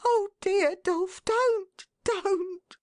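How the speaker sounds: a wife begging her husband to stop, tearful; pleading cry. A narrator's reading. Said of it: "cried his wife".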